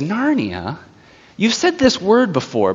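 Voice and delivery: soft, lightly voiced witch, lifting high; witch feigning puzzlement. Witch doubting the children's word, soft feigned puzzlement. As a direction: play a mildly coaxing witch, quietly doubting.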